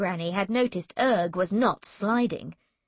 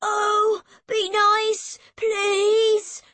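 high, loud, drawn-out plea; begging boy; 11 semitones higher, 5 dB louder, much slower than usual. A narrator's reading. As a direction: wail it imploringly as a small boy. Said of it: "Erg begged her".

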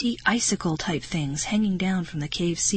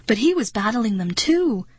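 plain reading voice

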